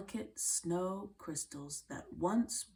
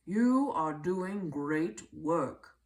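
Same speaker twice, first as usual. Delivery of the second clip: slow, louder, a lightly voiced proud professor; praising professor; 6 dB louder, slower than usual. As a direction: give the praise slowly, like an impressed scholar. Professor praises Willie's work.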